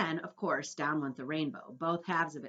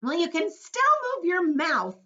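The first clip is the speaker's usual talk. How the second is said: earthworm voice; 11 semitones higher, 10 dB louder than usual. A narrator's reading. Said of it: "the earthworm said".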